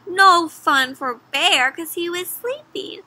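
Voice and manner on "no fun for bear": plain reading voice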